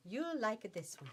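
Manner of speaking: lightly put-on Italian accent; mock Italian accent. Seller pitching blue fabric to shoppers, playful Italian accent. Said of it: "I went Italian".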